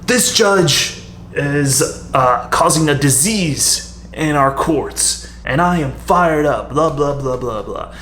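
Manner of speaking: slow, drawn-out fired-up declaration; upstart rebel. Shown as a demonstration. Writer demos the rebellion route, fired-up upstart voice. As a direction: play an outraged rebel denouncing the courts. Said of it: "an upstart rebellion sort of thing".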